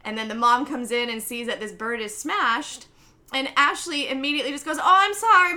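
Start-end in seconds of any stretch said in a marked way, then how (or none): 4.8-5.5 high, loud rush to apologize; mock apology. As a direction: imitate an anxious confession to mom. Hasty apology to mom, retold.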